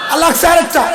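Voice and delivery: excited rising. Real, not acted. Excited crowd chanting build.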